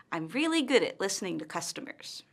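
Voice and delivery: brisk statement stepping down to a fall on "customers"; falling intonation. Shown as a demonstration. Teacher demonstrates a statement, voice falling on "customers". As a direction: let the pitch fall at the end on "customers". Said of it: "her intonation falls at the end of the statement".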